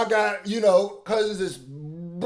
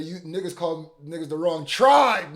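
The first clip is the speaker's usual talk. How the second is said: normal talking voice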